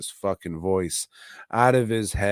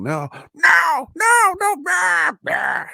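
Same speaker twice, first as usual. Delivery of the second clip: shrieking high, loud "No, no, no" yell; bad Sam Kinison scream; 8 dB louder, slower than usual. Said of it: "that is a terrible, terrible, uh, impression".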